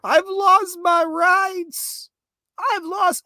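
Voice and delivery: high, slow, mocking whine of "I've lost my rights"; stretched-out sarcastic wail; mocking whiny quote. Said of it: "go on about how".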